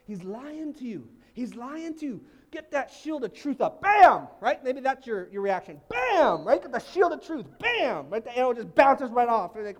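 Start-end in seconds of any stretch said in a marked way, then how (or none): 3.8-4.3 shouted Bam, much louder and higher. Pretend. Pastor acting out the shield of truth with a loud bam. 5.9-6.4 shouted Bam, much louder and higher. Pretend. Pastor acting out the shield of truth with a loud bam.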